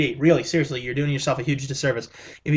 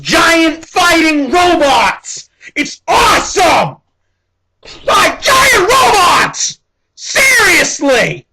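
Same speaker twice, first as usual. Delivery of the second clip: much higher and louder, slow stretched yells; ecstatic shout; 11 semitones higher, 9 dB louder, much slower than usual. Real, not acted. Vlogger hyping the movie's robot fights to viewers, shouting. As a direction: scream it out with pure glee.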